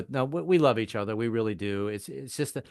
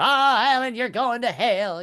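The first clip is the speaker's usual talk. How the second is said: high, loud wail of mock damnation; preacher grandfather; 11 semitones higher, 6 dB louder, slower than usual. Grandfather condemning his drinking grandson, high preacher's wail. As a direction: cry out like a scandalized preacher. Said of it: "personal sermons right at the breakfast table".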